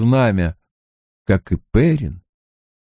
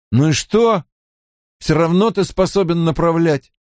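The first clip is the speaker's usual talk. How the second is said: higher-pitched grumbled retort; grumbling; 6 semitones higher than usual. A narrator's reading. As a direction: grumble back at your friend. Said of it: "бурчал Мэтт". (ворчливо возразить)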